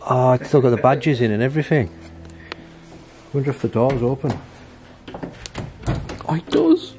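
ordinary delivery

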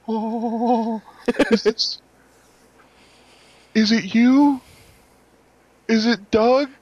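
put-on high, loud, breathless kid's voice; excited kid. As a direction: squeal it like a starstruck child. Thrilled nine-year-old meets hero.

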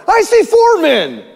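very high, drawn-out astonished cry; Nebuchadnezzar's shout. Acted. King sees four men in the fire, astonished shout. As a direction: shout it out slowly in amazement. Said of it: "Nebuchadnezzar shouted".

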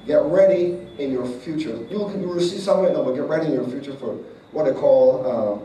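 fervent prophecy shouted over the congregation; preaching at full force. Acted. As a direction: proclaim it over her, rising to a crescendo.

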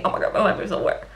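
fast, throwaway retelling of a lament; hurried, understated mock despair; quick imitated lament. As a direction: rattle off the character's complaint. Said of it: "situationship is essentially saying".

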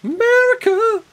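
high, loud mock cheer of America; a loud, high, hammed-up cry of America; shouted exclamation. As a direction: belt it out like a cheer.

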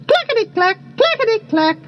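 high rattling bird call; wattlebird call. A narrator's reading. Wattle birds' call imitated.

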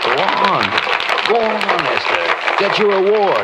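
eager, high and pressing, nudging her on; excited urging. Acted. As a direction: push her up to the stage with excitement. Urging Esther up to accept award.

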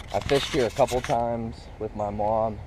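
usual speaking voice

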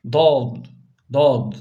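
dad said thick and full, the emphatic Arabic dad; heavy dad. Shown as a demonstration. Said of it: "Bu harfimiz dad'dır". (kalın dad sesi)

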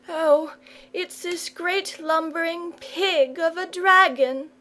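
put-on high tearful child voice; sobbing boy. A narrator's reading. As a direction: complain through tears. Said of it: "sobbed the boy".

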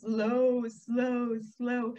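drawn-out slow and low. Shown as a demonstration. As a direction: stretch each word out slowly. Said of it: "this is slow".

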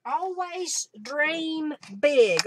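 slow, stretched words; drawn-out reading. Real, not acted. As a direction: savor each word of the motto. Reading out stamp text.

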